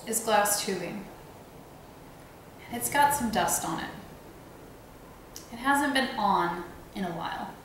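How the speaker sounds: calm, unhurried meditation voice with long pauses; guided meditation. Real, not acted. Meditation teacher guiding listeners to visualize their body outline, calm guided meditation. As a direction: guide them gently, leaving space between phrases.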